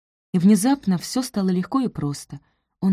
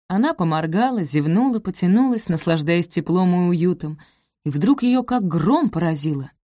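ordinary delivery, nothing marked

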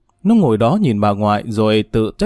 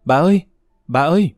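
higher-pitched call to grandmother; raised, clear calling voice; 5 semitones higher than usual. A narrator's reading. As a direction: call out for someone in the house. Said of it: "nó gọi rõ to".

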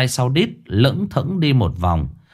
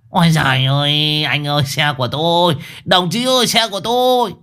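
put-on wailing old man; 6 semitones higher than usual. A narrator's reading. Chasing the towed car.

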